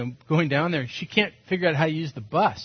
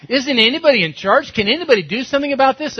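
exasperated inner voice; 9 semitones higher, 7 dB louder, faster than usual. Retold or imitated. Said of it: "'cause I'm thinking".

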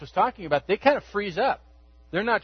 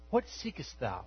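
put-on lower, softer, solemn old English; ethereal King James; 5 semitones lower, 11 dB quieter than usual. Jesus asking his followers, a hint of ethereal solemnity. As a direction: intone it like a holy vision. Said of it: "You know, very ethereal".

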